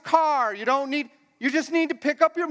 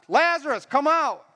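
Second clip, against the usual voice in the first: raised, higher calling voice; loud command; 4 semitones higher than usual. Acted. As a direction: summon him so all can hear. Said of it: "Why do you think he said it loud?".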